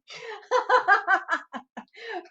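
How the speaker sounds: laughing hard, high squeals. Real, not acted. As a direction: break up laughing at the joke. Host laughs at joke.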